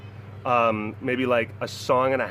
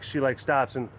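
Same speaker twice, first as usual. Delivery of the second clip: ordinary talk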